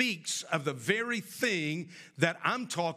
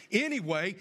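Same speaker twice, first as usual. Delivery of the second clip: normal talking voice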